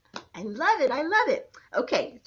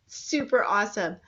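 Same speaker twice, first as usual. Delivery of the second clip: ordinary talk, nothing marked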